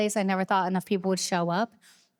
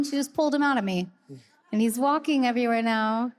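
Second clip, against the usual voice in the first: ordinary delivery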